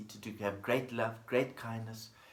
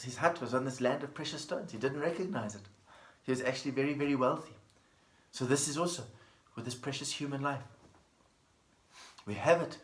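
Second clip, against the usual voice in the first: ordinary delivery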